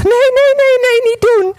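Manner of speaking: high, loud pleading cry; desperate shout. Acted. Vase carried back to the oven yells high and loud at the maker. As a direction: scream no at the oven door. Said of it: "En ik riep nog".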